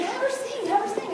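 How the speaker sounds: put-on high pleading kid voice; chanted children's plea, pitched high; little kids begging. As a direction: beg like an excited small child. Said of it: "all the little kids would say".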